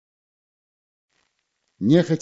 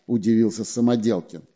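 ordinary delivery

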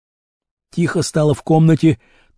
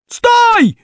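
very high, sudden call; shout from street; 4 dB louder than usual. A narrator's reading. Someone on the street halts the fugitive, shouting. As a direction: yell it out as a police halt. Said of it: "раздалось на улице".

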